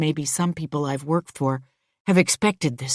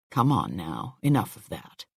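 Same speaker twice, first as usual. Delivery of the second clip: low, soft soothing voice; gentle comforting; 8 semitones lower, 5 dB quieter than usual. A narrator's reading. Man patting crying Louise's shoulder, soothing. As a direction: hush someone gently, voice dropped low.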